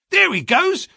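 high, loud exclamation; eager shout on spotting someone; excited cry. A narrator's reading. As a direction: call it out excitedly, pitched right up. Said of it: "cried Hall Pycroft".

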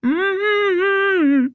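high closed-mouth straining hum; urgent muffled moan through a gag; gagged muffled cry. A narrator's reading. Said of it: "struggling to talk through the gag".

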